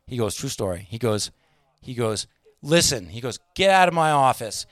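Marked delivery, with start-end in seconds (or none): 2.6-3.1 raised, emphatic command; top-of-voice outburst. Retold or imitated. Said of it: "He goes to the top of his voice". 3.6-4.7 loud, high, furious retold shout; full-volume angry tirade; top-of-voice yell. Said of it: "He goes to the top of his voice".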